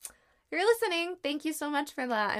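loudly and pointedly thank her, dripping sarcasm; sarcastic thanks. Real, not acted. Sarcastic thanks to sister for seating.

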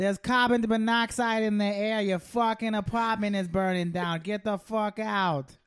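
put-on emphatic Boston accent alarm. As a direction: warn them like a Bostonian. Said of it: "that was my Boston accent".

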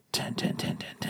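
breathy whispered chant of the score. Real, not acted. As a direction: whisper it to yourself like a secret. Whispers his score to himself.

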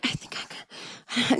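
soft, unsure whisper to herself; whispered doubt. Retold or imitated. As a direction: barely breathe the words. Quiet unsure self-talk.

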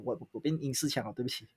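ordinary delivery

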